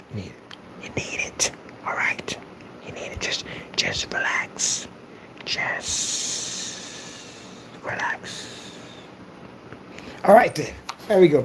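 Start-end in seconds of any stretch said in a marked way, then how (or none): 0.1-8.7 slow soft whisper into the mic; mock soothing ASMR whisper. Shown as a demonstration. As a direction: whisper it like a lullaby. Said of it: "You need the A M S R".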